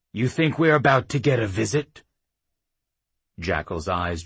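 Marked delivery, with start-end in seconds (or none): 0.1-2.0 heated chief. A narrator's reading. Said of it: "his voice gaining a little heat".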